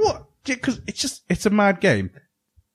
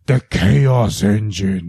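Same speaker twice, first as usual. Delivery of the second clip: slow, drawn-out movie-trailer announcer; dramatic announcer voice; 2 semitones lower, 4 dB louder, much slower than usual. Pretend. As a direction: boom out the title like a trailer voice. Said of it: "You have to say it in that accent".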